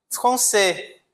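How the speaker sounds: "Français" with silent final S, fran-sê; teacher says "Français" without the S; French demo. Shown as a demonstration. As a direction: model "Français" and drop the S. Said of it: "eu não pronunciei o S".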